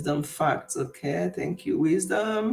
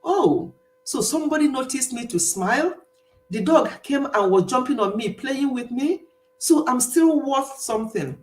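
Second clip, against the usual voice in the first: dawning realization; 6 semitones higher than usual. Acted. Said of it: "made him to think".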